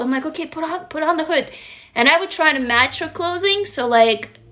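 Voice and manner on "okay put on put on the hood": gentle parent. Retold or imitated. Parent to imagined child on a cold trail, softly tender. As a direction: coax her like a tender parent, quietly. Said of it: "I felt literally like a parent".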